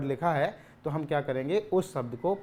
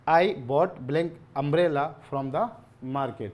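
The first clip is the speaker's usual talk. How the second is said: reading the sentence out word by word, very slowly; slow and loud, like dictating to the class; slow dictation; 5 dB louder, much slower than usual. Shown as a demonstration. Teacher reading the exam sentence to students, very slowly. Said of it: "देखिए लिखा है".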